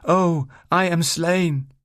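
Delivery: very high, slow tragic wail; hammed-up Shakespearean dying cry; theatrical death line. A narrator's reading. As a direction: overact the death, stretching every word. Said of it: "she repeated".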